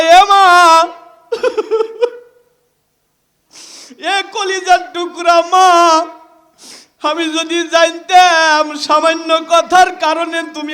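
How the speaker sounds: loud, high wail over the shroud; wailing lament. Acted. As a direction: cry out to her loudly. Child laments at mother's shroud.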